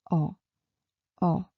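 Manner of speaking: "o" low from the throat; low and soft. Shown as a demonstration. Teacher showing the throat sound, low "o". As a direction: let "o" sit low and soft. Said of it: "low and soft".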